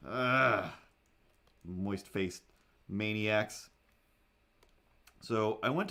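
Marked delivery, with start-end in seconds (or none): none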